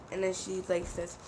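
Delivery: ordinary delivery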